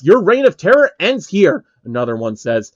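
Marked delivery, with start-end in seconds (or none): 0.0-1.7 raised, higher battle cry; shout. Acted. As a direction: call out a challenge. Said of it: "One of them shouts".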